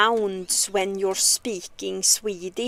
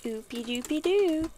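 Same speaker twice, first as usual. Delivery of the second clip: playful sing-song; 7 semitones higher, 3 dB quieter than usual. Real, not acted. Humming nonsense while playing.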